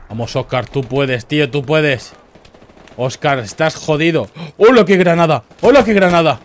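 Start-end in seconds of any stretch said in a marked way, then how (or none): 4.6-6.4 loud, higher exclamation of amazement; excited shout. Real, not acted. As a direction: cry out at the big grenade. Reacting to a grenade. (exclamación fuerte de asombro)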